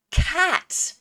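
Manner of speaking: delighted discovery. Pretend. Child cracks the secret code.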